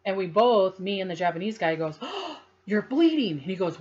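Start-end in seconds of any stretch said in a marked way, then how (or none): 2.0-3.3 gasped, high-pitched alarm; retold shock. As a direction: act out a startled discovery, pitch leaping up and louder. Retold shocked discovery of blood.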